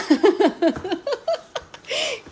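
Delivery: high laugh breaking into a squeal; giddy laughter; screaming laugh. Real, not acted. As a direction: laugh off the fright.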